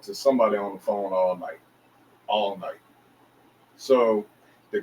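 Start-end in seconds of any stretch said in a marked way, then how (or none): none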